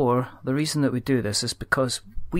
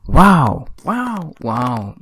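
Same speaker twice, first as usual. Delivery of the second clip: pitch warm-up wows; 3 semitones higher, 7 dB louder, much slower than usual. Shown as a demonstration. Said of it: "Just getting the octave".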